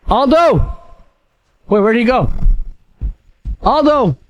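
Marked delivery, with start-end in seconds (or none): 0.1-0.8 shouting a name across the woods; calling out. Real, not acted. Calling for missing friend. 3.6-4.2 shouting a name across the woods; calling out. Real, not acted. Calling for missing friend.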